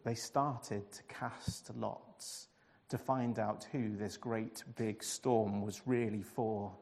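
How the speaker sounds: usual speaking voice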